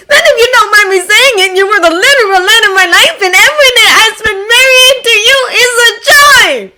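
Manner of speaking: high, loud, half-crying with joy; joyful tearful declaration. A narrator's reading. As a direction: gush it out, voice near tears. Hisashi's emotional declaration.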